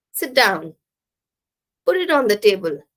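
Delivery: imperative dropping on "down" and "table"; falling intonation. Shown as a demonstration. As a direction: give commands that fall at "down" and "table". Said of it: "here also we use falling intonation".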